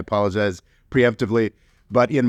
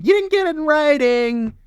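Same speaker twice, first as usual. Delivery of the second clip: much higher sneering put-on voice; mocking taunt; 4 dB louder than usual. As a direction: jeer it at the loser. Mocking the US excuse again.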